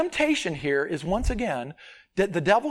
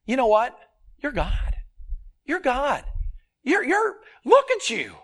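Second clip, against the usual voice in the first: voicing Satan tempting; 6 semitones higher, 3 dB louder than usual. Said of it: "He's like".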